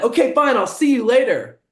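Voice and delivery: high, loud mock dismissal; loud, huffy retold send-off; defiant brush-off. Acted brush-off of his rival.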